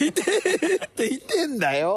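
high, comic yelp of pain; imitating a pained yell; mock pained yell. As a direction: yelp as if the pen really hurt. Retelling being stabbed with pens.

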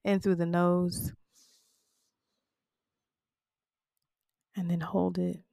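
quiet guided-breathing cue. Acted. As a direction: coach them gently through each breath. Guided breathing exercise for listeners.

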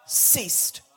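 loud, rising preacher's cry; impassioned sermon build; full-force preaching. Acted. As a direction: drive it home from the pulpit.

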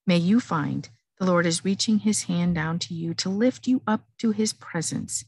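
ordinary talk, nothing marked

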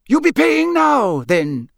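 high, loud campsite keeper; Mister Roberts. Acted. Campsite keeper asking for payment, high and loud. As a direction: press for money in a high voice.

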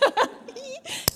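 high, delighted giggle; happy laugh. Real, not acted. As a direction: giggle with real joy, high up. Happy laugh after the story. (hohes, freudiges Kichern)

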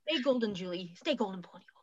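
breathy whispered sign-off. Real, not acted. Whispering the new catchphrase to listeners. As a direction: whisper it like a secret farewell. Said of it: "whispered sign-off".

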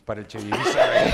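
laughing through the words. Real, not acted. Repeating the joke amid laughter.

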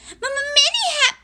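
very high, quick, loud stutter; squeaky small-creature blurt; nervous stammering Piglet. Acted. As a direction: rush it in a tiny high voice. Piglet stammers greeting again.

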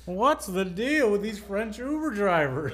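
slow, drawn-out stand-up impression; Seinfeld. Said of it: "That's my Seinfeld impression".

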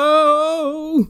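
a bright goofy greeting; mock Dale Cooper hello. Cooper in the audience greeting, hammed-up. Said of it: "He's like".

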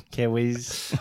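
Kiwis tried with a Kiwi accent, clipped vowel; New Zealand accent. Shown as a demonstration. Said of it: "Is that how you say it?".

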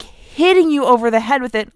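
ordinary talk, nothing marked

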